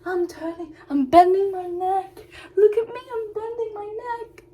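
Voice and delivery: high, eager talking-horse voice; horse's proud voice. Retold or imitated. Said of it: "He was like".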